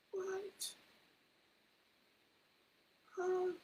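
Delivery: high, hushed, baffled question; stunned reaction. Retold or imitated. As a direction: squeak it softly in disbelief. Acts stunned reaction to wife.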